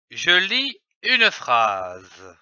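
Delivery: slow, low reading-lesson voice; slow announcement. Shown as a demonstration. Teacher announcing the next step to pupils, slow. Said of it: "lesson step announced".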